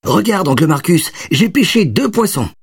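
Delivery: put-on high, eager little boy voice; excited child. A narrator's reading. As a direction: burst in like a proud kid. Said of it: "déboula dans le salon". (voix d'enfant excité)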